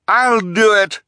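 very high, loud echoing cry. A narrator's reading. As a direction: pipe up loudly, top of the range. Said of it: "cried the other one".

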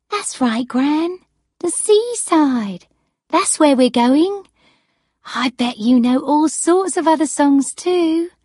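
high, loud, chivvying granddaughter; encouraging granddaughter. Acted. Granddaughter to gran about the seaside, high encouraging voice.

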